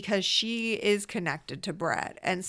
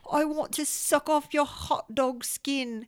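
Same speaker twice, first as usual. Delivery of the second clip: higher, slow British nanny tease; naughty British nanny voice; 7 semitones higher, slower than usual. Retold or imitated. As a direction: purr like a saucy British nanny. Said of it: "naughty British nanny voice".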